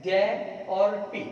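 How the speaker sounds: द्य और पि slowly, syllable by syllable; spelled slowly. Shown as a demonstration. Teacher spelling the word for students. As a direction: break it into its parts slowly.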